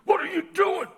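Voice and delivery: hammed-up alarmed friend in the back row; buddy Bob. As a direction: blurt it like a shocked drinking buddy. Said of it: "Bob sitting right next to me goes".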